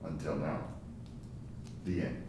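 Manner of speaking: usual speaking voice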